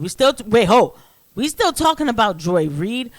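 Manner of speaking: wait, are we really still on this; incredulous disbelief. Real, not acted. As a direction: do a double take out loud. Incredulous at Joy Reid headline.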